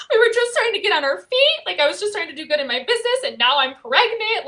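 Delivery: retold panic, pitched high; panicked inner voice. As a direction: spill it out in a tearful rush. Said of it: "in my mind, I'm like".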